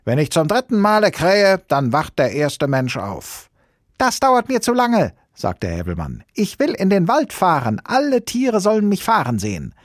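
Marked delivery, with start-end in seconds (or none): 0.0-3.5 high, loud rooster proclamation; calling down. A narrator's reading. Rooster calls down from tower. 4.0-5.1 put-on very high, loud child-character voice; piercing impatient outburst; impatient shout. A narrator's reading. Impatient little boy in story. 6.3-9.7 put-on high, loud child-character voice; piercing, insistent demand; demanding shout. A narrator's reading. Boy demands a ride, in story.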